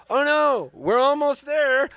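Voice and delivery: high nervous reassurance. Retold or imitated. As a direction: cover up nervously. Nervous covering.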